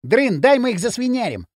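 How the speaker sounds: high-pitched, aggressive bark. A narrator's reading. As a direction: bark eagerly for permission to attack. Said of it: "рявкнул бивень". (высоко, задиристо рявкая)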